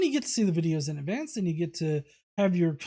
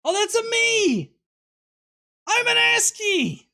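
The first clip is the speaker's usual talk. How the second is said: much higher and louder, slow squeaky shout; cartoon plumber voice; 8 dB louder than usual. Reading a viewer's joke comment in a silly high cartoon shout. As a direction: yell it like a goofy cartoon plumber. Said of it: "here's a".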